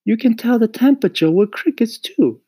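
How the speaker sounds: put-on high, loud boyish voice; insistent boy Mario. A narrator's reading. Mario defends crickets to Mama, high and insistent. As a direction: argue the point high and emphatic.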